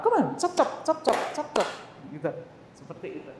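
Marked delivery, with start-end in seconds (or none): 0.1-1.7 put-on high, bouncy chop chop to a child; playful hurry-up. Shown as a demonstration. Teacher hurries a small child along, high playful chop chop. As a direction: chirp chop chop like a game to speed them up. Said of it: "We modify".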